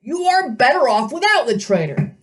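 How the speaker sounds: put-on high, indignant old satyr voice; outraged old goat-man shouting; fussy old satyr. A narrator's reading.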